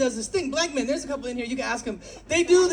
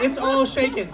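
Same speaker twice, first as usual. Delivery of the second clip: ordinary delivery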